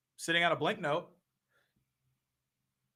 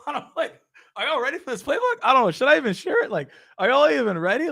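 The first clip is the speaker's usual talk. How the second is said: playful teasing; 7 semitones higher than usual. Acted. Teasing audience about playbook.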